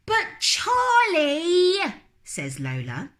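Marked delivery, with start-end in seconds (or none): 0.0-1.9 high, slow, drawn-out whine; whiny little girl. Acted. Lola whining at her brother, drawn out. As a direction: stretch it out like a whining child.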